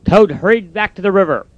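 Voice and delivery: usual speaking voice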